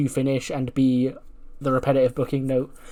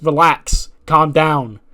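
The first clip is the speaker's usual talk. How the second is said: calming shout; 2 semitones higher, 7 dB louder, much slower than usual. Retold or imitated. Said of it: "And Mackie goes".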